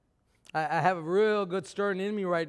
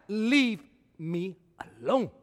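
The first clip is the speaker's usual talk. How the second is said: sulky take; slower than usual. Acted. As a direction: drop it into a sulky grumble. Quieter, sulky take of same words.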